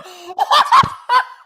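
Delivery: high, loud burst of laughter; loud laughing shout. Real, not acted. Reaction to the trailer.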